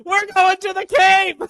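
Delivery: big drawn-out excited yell; slow, high, loud shout of joy. Real, not acted. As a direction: shout it out like a fan. Caller shouting in voicemail.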